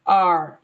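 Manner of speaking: "R" as "ar", ending on a curled r; American R sound. Shown as a demonstration. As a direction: rhotic "R" with the final r sounded. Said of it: "if you're using American standard".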